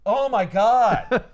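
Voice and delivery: high, loud, drawn-out acted groan; big exasperation. Venom despairs at the clueless parents, exasperated. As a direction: stretch it out in hammed-up disbelief. Repeats it, bigger and slower.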